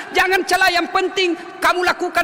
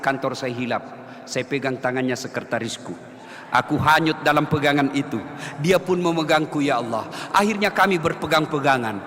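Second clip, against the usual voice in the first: mock repentant prayer; 9 semitones lower than usual. Preacher mimics a guilty husband praying for forgiveness, low and pleading. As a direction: murmur like a guilty man asking forgiveness. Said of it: "anggap saja".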